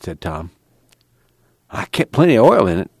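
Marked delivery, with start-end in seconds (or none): 1.7-2.9 higher-pitched, mildly aggrieved protest; complaining. A narrator's reading. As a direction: complain in self-defence. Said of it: "Al complained".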